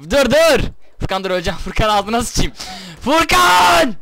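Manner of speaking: loud panicked pleading; panicked yelling. Real, not acted. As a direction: yell at him to stop. Being killed, yelling at friend.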